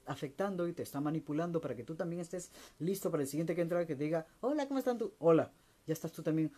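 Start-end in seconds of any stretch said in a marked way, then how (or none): 4.4-5.1 bright mock greeting. Next person greeted by soured you. 5.2-5.5 gruff loud hello. Retold or imitated. Grumpy greeting back.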